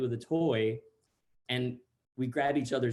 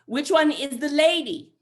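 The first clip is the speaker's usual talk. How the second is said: put-on very loud, high, drawn-out child voice; mock kid shouting each word slowly; insistent six-year-old; 12 semitones higher, 9 dB louder than usual. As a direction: demand it like a pestering little boy. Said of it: "six-year-old".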